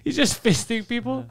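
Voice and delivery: ordinary talk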